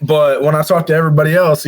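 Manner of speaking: ordinary delivery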